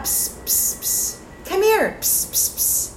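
hissed psst calls; stage whisper. Shown as a demonstration. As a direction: quietly hiss to call someone over. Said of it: "It's more quiet".

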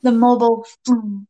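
usual speaking voice

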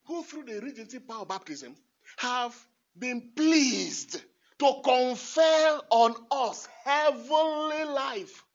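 building from quick to loud and slow; fervent prayer reading. Acted. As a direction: build the prayer line up to a proclamation.

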